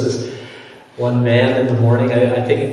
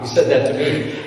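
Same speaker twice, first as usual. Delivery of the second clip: ordinary delivery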